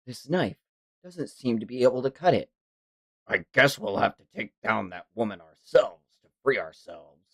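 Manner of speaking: low, subdued character read; lightly voiced manga fighter. Manga reader voicing a fighter's resolve, low and subdued. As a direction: read the figure's lines low and soft. Reads a character's lines aloud.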